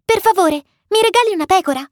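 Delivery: high, quick pleading little voice; vocina insistente. A narrator's reading. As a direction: ask insistently in a tiny prince's voice. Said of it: "chiese di nuovo quell'esserino misterioso". (vocina acuta e supplichevole)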